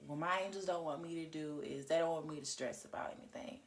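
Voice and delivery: normal talking voice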